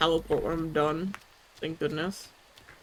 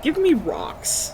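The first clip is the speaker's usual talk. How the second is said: slow, higher, louder demand; drawn-out demand; 4 semitones higher, 4 dB louder, much slower than usual. Acted. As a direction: stretch each word out. Slow comic demand repeat.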